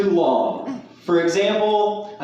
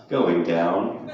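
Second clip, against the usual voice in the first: much lower, sultry elevator voice; sensual voice; 13 semitones lower than usual. Acted. Comic imitates an elevator announcing its floor, sensual voice. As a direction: purr it low like a seductive elevator. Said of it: "it said in a sensual voice".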